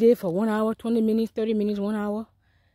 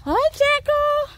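very high, drawn-out hello; high sing-song call; much slower than usual. Real, not acted. Woman calls after Jacko who left, high sing-song hello. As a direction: call out sweetly, slow and way up high.

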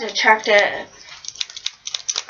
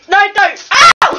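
sharp real yelp of pain; pained shout; 7 semitones higher, 5 dB louder, slower than usual. Real, not acted. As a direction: cry out as it rips. Tape pulled, real pain.

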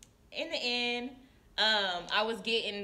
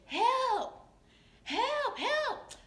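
high, strained cries of help, rising each time; imitated calls for help; 13 semitones higher, 4 dB louder, much slower than usual. Nurse imitating the resident crying out for help, high and strained. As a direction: call out help like a resident stuck and hurting.

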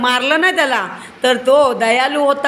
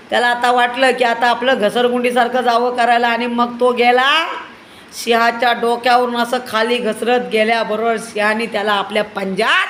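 ordinary delivery, nothing marked

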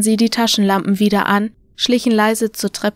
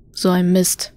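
grumbling; 2 semitones lower than usual. A narrator's reading. Someone downstairs at the stairs, grumbling mildly. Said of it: "brummte jemand unten an der Treppe".

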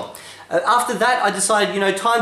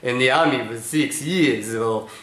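put-on a boss with a heavy Israeli accent; 5 semitones lower than usual. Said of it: "he has a heavy Israeli accent".

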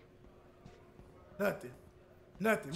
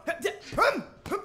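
effort grunts; 7 semitones higher than usual. Acted. Boxer throwing punches, high grunts.

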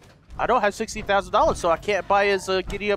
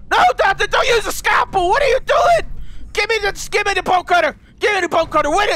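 high, loud, frantic orders; panicked shouting; 10 semitones higher, 4 dB louder than usual. Pretend. Player stopping the teddy doctor's surgery, panicked shouting. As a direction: shout in mock operating-room panic.

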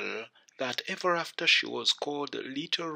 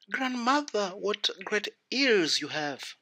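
put-on wondering little girl; 7 semitones higher than usual. A narrator's reading. Girl wonders at changed grandmother.

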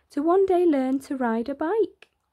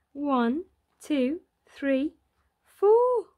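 gleeful counting; 4 semitones higher, much slower than usual. A narrator's reading. Narrator counting chicks for children, rising to a squeal. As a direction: count up with growing delight.

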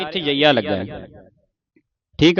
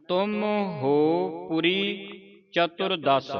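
ਤੁਮ and ਹੋ drawn out as distinct words; slow, softer, higher reading, ਚਤੁਰਦਸ as one unit after a pause; separated words; 5 semitones higher, 9 dB quieter, much slower than usual. Shown as a demonstration. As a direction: stretch ਤੁਮ ਹੋ ਪੁਰੀ word by word. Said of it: "ਵੱਖਰਾ ਵੱਖਰਾ ਬੋਲਣਾ ਹੈ".

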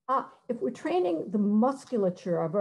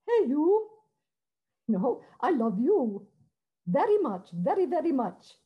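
much higher, pure British choirboy tone on "Hey, you"; British boys' choir pure sound; 5 semitones higher than usual. Shown as a demonstration. Speaker to choir teachers, contrasting pure British choir diction. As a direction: float it high and pure like an English boy chorister. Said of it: "more like British boys' choirs ... that pure sound".

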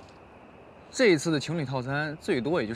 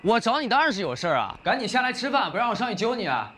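calling out; 7 semitones higher than usual. Acted. 朝楼上喊下来吃饭.

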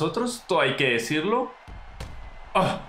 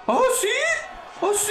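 very high squealing oh yes; excited cry; faster than usual. Real, not acted. Streamer reacts to the takedown attempt, very high excited squeal. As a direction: squeal it out in delight.

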